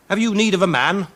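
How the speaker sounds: high, loud call; eager offer. Acted. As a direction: offer yourself boldly. Champion asks for work.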